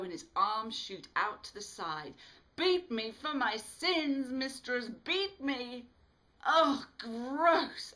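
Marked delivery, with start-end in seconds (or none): 2.6-5.9 higher, louder, slow theatrical plea; hammed-up begging. A narrator's reading. Smitty showing off to the others, mock begging. 6.4-7.9 much higher, loud squeal of disgust; disgusted cry. A narrator's reading. Alice crying out at Smitty's stunt in disgust. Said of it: "Alice cries".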